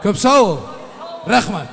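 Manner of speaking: loud, bright thank-you to the hall; booming wedding announcer. Real, not acted. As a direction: call it out over the music. Wedding host thanking loudly.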